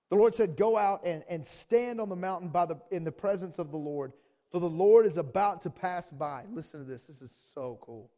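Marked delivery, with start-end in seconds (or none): 0.1-6.5 loud, raised preaching voice; forceful scripture reading. A narrator's reading. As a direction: proclaim the verse loudly. Preacher reads scripture at full force.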